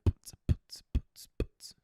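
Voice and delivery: whispered beat-box thump; imitated techno beat. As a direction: mimic the DJ's beat under your breath. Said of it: "He's like".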